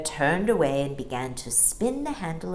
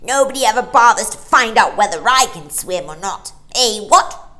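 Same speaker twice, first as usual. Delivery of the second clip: loud, leering taunt; bitter angry; 5 semitones higher, 11 dB louder than usual. A narrator's reading. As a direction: sneer it bitterly. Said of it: "bitter angry voice".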